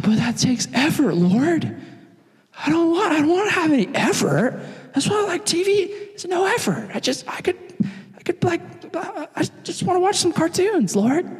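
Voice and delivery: high-pitched reluctant whine; whiny protest. Retold or imitated. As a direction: plead like a child who won't make the effort. Whining to God about making effort.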